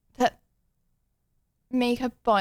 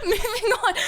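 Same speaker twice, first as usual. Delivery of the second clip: laughing; 7 semitones higher than usual. Real, not acted. Laughing, changing the subject.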